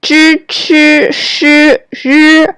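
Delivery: zhi, qi, shi, ri with the tongue curled back; curled tongue initials. Shown as a demonstration. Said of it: "These are called curled tongue initials".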